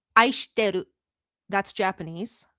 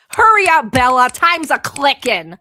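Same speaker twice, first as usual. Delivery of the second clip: mock impatient call; 3 semitones higher, 11 dB louder than usual. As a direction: rush her along, loudly and teasing. Teasing a viewer to answer.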